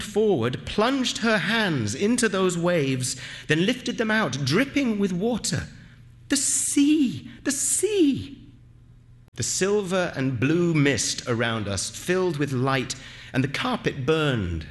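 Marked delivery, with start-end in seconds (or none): 6.3-8.4 high, joyful cry of discovery; excited exclamation. A narrator's reading. Aminta lifting hands dripping with seawater, high excited cry. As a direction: exclaim it twice, pitched way up.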